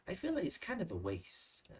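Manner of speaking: "kind of a" linked smoothly inside a slow model sentence; linked sounds. Shown as a demonstration. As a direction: model "kind of a waste" slowly, words joined. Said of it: "Nối âm vờ sang". (đọc mẫu chậm, nối âm "kind of a")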